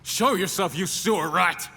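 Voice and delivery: high, challenging yell at the dark; defiant shout. Acted. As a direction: call out the hidden foe. Challenging hidden enemy.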